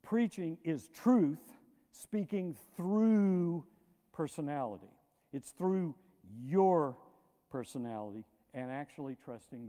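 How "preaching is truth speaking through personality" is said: very slow, weighty quote; solemn quotation. Retold or imitated. Quoting Phillips Brooks to preachers, very slow and solemn. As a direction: lay out each word with reverence. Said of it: "who said".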